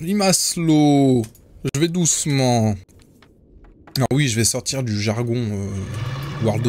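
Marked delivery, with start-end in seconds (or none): 0.0-2.7 slowed-down speech. Pretend. Slowed by enemy spell.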